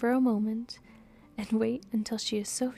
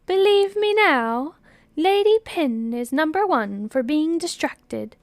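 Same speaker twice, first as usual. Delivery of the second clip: triumphant accusation; 6 semitones higher, 10 dB louder than usual. Acted. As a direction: rub it in loudly to the friend. Friend triumphant in acted quarrel.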